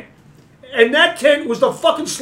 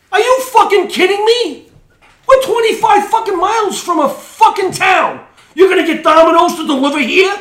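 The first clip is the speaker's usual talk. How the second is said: incredulous, louder and higher outburst; real angry rant; 7 semitones higher, 4 dB louder than usual. Real, not acted. Venting to the group about a camper, angry and incredulous. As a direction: blow up in disbelief at the guy. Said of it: "I'm done with that rant".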